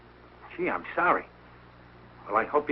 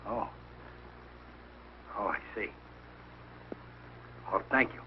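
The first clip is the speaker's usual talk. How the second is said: quiet, low, deflated; crestfallen; 6 semitones lower, 14 dB quieter than usual. Acted. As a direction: let the realisation sink in softly. Learns nobody is coming.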